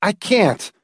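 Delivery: high, strained protest; desperate admission; distressed refusal. A narrator's reading. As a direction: protest helplessly, pitch up. Trapped man refuses his wife.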